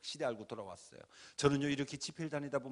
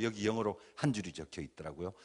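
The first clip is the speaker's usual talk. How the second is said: usual speaking voice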